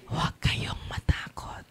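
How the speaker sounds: soft, voiceless whisper; hushed whisper; whispered reassurance. Real, not acted. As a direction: whisper it to calm the congregation.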